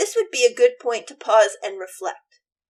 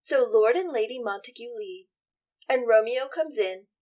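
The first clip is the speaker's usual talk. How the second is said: normal talking voice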